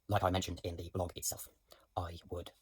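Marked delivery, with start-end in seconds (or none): 2.0-2.5 whisper. Real, not acted. Aside while looking for notes.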